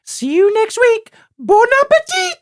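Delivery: very high, loud falsetto sign-off; Julia Child in Elmo's voice. Acted. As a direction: sing out a TV catchphrase in a squeaky puppet-like voice. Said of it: "in Elmo's voice, she'll be like".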